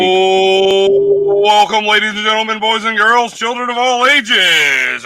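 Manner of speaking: booming circus ringmaster intro. Pretend. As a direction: announce it like a big-top showman. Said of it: "I can't stop doing that 'cause I really enjoy it".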